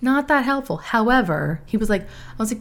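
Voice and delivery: ordinary delivery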